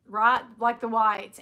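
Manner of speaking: ordinary talk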